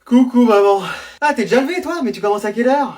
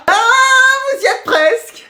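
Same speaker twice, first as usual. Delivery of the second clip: high coaxing; 14 semitones higher, much slower than usual. Acted. Teacher coaxing answer.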